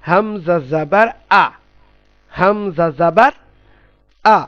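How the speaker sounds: slow spelling chant "হামজা জাবার আ", ending on a clear open a; teacher slowly modelling "হামজা জাবার আ"; model pronunciation. Shown as a demonstration. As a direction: draw out "হামজা জাবার", then give "আ" separately. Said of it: "তাহলে এখানে কি হবে উচ্চারণটা আসলে?".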